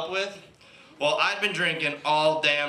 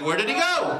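lightly voiced puzzled kid, higher; a child wondering aloud, mildly high; kid's voice; 9 semitones higher than usual. Kids looking for the ice cream truck, puzzled kid voice.

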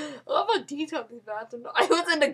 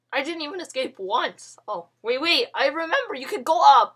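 ordinary talk

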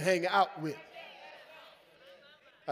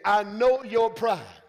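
loud, slow mock scolding; hammed-up jealous big brother; preacher voicing Eliab scolding David; 9 dB louder, slower than usual. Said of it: "look what he said".

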